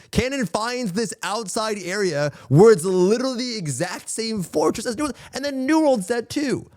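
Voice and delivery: incredulous rant about a copied fortress; worked-up rant. Real, not acted.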